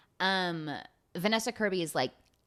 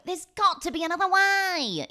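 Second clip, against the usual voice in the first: melodramatic hero plea; 13 semitones higher, 6 dB louder than usual. Retold or imitated. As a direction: belt it like a movie climax. Dramatic repeat of the same line.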